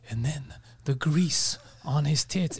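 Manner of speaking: slow, low, mock-profound delivery; intense, deep-meaning. Co-host mimicking an intense comedian telling a joke, slow mock gravity. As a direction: draw it out quietly as if profound. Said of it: "he's talking to you like this, like it's something deep".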